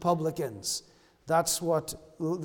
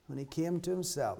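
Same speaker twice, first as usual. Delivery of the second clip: much softer, almost to himself; soft repetition; 3 semitones lower, 10 dB quieter than usual. Acted. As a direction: let it fall quietly, reflective. Repeating the verse for effect.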